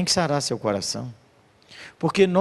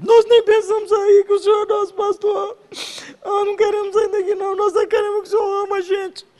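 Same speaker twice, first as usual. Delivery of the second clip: very high, louder tearful plea; girl weeping as she tells the pastor they will stay; crying girl; 6 dB louder than usual. Retold or imitated. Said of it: "chora que não para de chorar".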